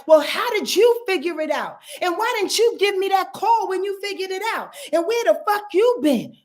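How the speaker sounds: high, pushy mock interrogation; indignant questioning. Others confronting the one who moved on, indignant. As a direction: act out people demanding answers. Voicing resentful people confronting her.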